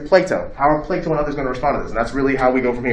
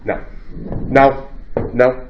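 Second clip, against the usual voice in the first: ordinary talk, nothing marked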